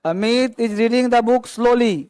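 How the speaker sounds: very slow, every word spaced apart; slow dictation. Shown as a demonstration. Teacher to students giving an example, dictated very slowly. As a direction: read it out slowly for copying. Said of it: "teacher dictating example sentence".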